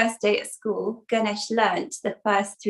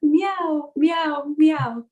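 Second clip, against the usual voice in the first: three higher, drawn meows; worried cat meowing; 4 semitones higher, much slower than usual. Acted. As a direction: meow like an uneasy cat. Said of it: "starting to get a bit worried".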